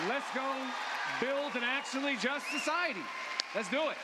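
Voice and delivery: loud, high call to action; shouted rally cry. Real, not acted. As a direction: fire the crowd up to go. Rallying the crowd at speech end.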